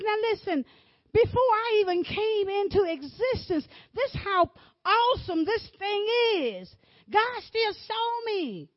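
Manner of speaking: loud, high preacher's cry; rising sermon shout; full-force preaching. Acted. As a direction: preach it out with fire.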